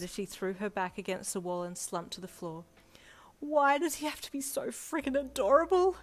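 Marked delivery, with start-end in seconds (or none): 3.4-6.0 high, loud, breathy wail; overwrought fangirl. A narrator's reading. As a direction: wail helplessly over your crush. Fangirl clerk wailing over crush.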